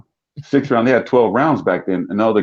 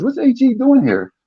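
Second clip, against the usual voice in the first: surprised coach. Retold or imitated. Coach asks the other coach.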